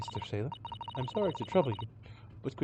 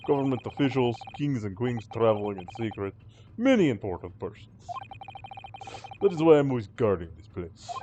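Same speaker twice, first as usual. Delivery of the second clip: put-on proud guard in a Russian accent; Russian-accented sailor; 6 semitones lower than usual. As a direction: brag like a Russian crewman. Sailor boasts of guard duty.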